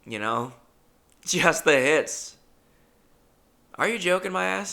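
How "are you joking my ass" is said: higher, bouncy catchphrase delivery; catchphrase in character. Shown as a demonstration. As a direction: toss the catchphrase out proudly. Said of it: "it rolls off the tongue".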